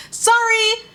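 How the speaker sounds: SORRY pitched very high and stretched; over-the-top, high-pitched "Sorry"; exaggerated sorry. Real, not acted. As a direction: sing out "Sorry" high and long. Exaggerated repeat of the apology.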